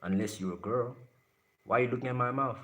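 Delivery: ordinary delivery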